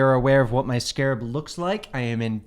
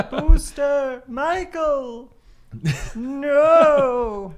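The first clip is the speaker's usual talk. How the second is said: high laughing dramatic wail; overacted death scene; 10 semitones higher, much slower than usual. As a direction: cry out over a fallen friend. Mourning fallen friend, laughing.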